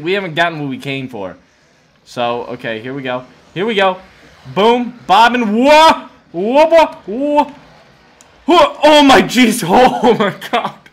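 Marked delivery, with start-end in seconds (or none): 4.5-10.8 panicked yelps. Real, not acted. Near fall while platforming.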